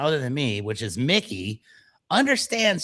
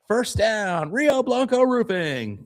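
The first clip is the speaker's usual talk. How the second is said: high, slow announcer call; 9 semitones higher, slower than usual. Retold or imitated. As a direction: boom it like a stadium promo. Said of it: "quoting his football ad".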